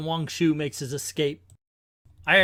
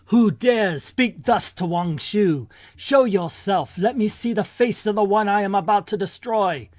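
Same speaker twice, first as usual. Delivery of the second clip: menacing warlord; 4 semitones higher than usual. Acted. Warlord answers a challenger in the courtyard, menacing. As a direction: demand it like a comic-book tyrant.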